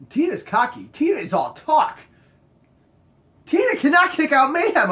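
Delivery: high, rising emphatic dismissal; mock exasperated rant. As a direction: imitate a worked-up friend scoffing. Crew member's retold rant about Tina.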